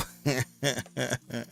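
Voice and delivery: quiet run of short laughs; chuckle. Real, not acted. Host inviting listeners to open forum, soft chuckle. Chuckling after calling for guests.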